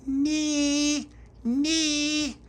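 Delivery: put-on very high baby squeal. A narrator's reading. Baby Lucia squeals along at Tony's door, very high.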